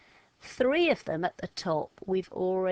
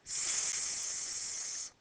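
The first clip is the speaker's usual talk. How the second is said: softer, unvoiced "S." drawn out like a snake; snake hiss; 6 dB quieter than usual. Shown as a demonstration. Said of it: "you're making a snake action and go".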